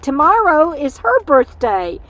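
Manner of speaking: normal talking voice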